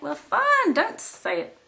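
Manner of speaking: high, sulky mock offence; mock huffy. To viewers who won't share, high mock huff. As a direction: pretend to be put out.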